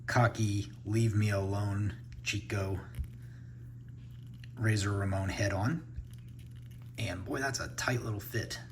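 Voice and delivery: ordinary talk, nothing marked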